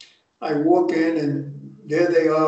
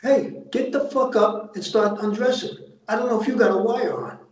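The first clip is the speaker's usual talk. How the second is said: higher, fast, mock tough order to strip; tough demand; 5 semitones higher than usual. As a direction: bark it like a suspicious wiseguy, a bit hammed-up. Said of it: "I'm going".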